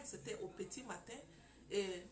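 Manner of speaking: ordinary talk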